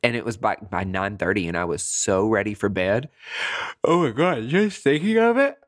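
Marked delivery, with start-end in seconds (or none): 3.8-5.6 high, sleepy yawn-laden voice; talking through a yawn. Real, not acted. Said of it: "it's making me yawn right now".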